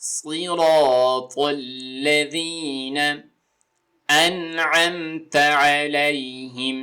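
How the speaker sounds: drawn-out Quranic recitation; slow recitation. Shown as a demonstration. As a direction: recite slowly and carefully. Recites verse.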